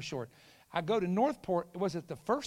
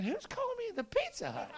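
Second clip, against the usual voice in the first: mock bewilderment; 3 dB quieter than usual. Puzzled thought acted in story.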